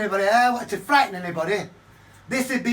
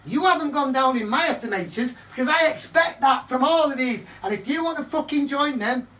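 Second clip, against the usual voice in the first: higher, louder defiant comeback; defiant retort; 4 semitones higher, 4 dB louder than usual. Retold or imitated. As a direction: fire it back, defiant. His defiant reply.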